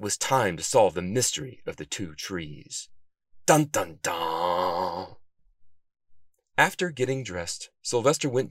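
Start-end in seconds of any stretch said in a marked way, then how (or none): 3.4-5.2 sung "dun dun dun" suspense sting; two punched notes, last one held long; dramatic sting. Pretend. Narrator caps the mystery with a mock-dramatic sting.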